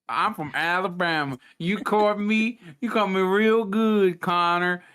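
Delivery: hammy fake Southern accent, barely shifted from his own; horrible Southern accent. Guest answering the host in a mock Southern accent, slowed down. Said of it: "doing a horrible Southern accent".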